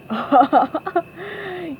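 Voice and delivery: high acted chuckle; Mister Krabs's laugh. A narrator's reading. Mister Krabs laughs at the costumes, high chuckle. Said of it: "Mister Krabs laughed".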